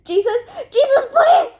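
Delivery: high, loud cry of panic; escalating squeal for help; desperate begging. A narrator's reading. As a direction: beg at the top of your voice. Science begs while being let fall.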